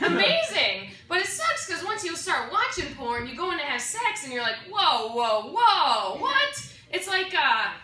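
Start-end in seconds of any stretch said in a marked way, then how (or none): 4.7-6.6 mock confused. Confused during sex.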